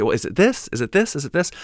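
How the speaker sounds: rising tune on each THIS; mock back-and-forth checking, each question lifting; questioning guesses. Writing back to check a name, repeated rising questions.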